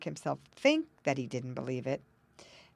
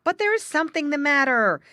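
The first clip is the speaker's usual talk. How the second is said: higher, louder insistent muskrat; insisting; 9 semitones higher, 10 dB louder than usual. A narrator's reading. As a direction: press the point firmly. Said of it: "insisted Jerry Muskrat".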